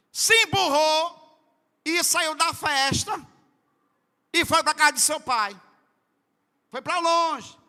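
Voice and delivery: loud, high storytelling sermon; fiery preaching. Real, not acted. Preaching at full force. (pregação inflamada, narrando em voz alta)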